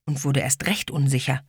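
ordinary delivery, nothing marked

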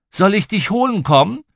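high, loud parental threat; warning call. A narrator's reading. Dad warns howling little girl on rocks, loud warning.

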